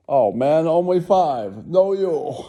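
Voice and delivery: high, loud mock boast; hammed-up high yell; bragging shout. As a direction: shout the one-up. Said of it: "rich man showdown".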